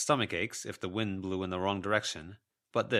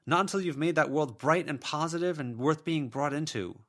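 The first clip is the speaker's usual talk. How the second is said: higher and louder, firm refusal; defiant young woman; 10 semitones higher, 5 dB louder than usual. A narrator's reading. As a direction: stand your ground against her. Verona lectures ghost.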